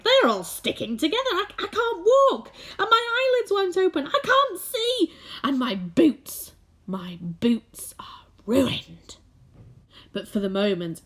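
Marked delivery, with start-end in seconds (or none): 0.0-6.5 high, loud distressed bug voice; panicking centipede. Acted. Centipede stuck in paint crying out, high loud panic. 6.9-9.2 whispered lament. Acted. Centipede mourning boots.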